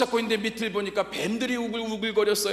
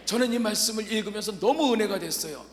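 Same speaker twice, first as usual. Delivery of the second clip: ordinary talk, nothing marked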